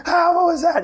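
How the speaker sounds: high-pitched startled question; spooked. Acted. Camper reacting to creepy shadows that move, spooked. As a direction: jump at a shadow, voice shooting up.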